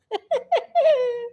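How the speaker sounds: high, tittering laugh; giggle. Real, not acted. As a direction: giggle high after the joke. Laughs after own nickname.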